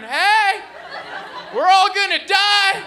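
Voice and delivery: high, loud panicked scream. Retold or imitated. Comic in the stalled Uber, screaming at the driver. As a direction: scream it like the car stopped on the freeway. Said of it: "I started screaming".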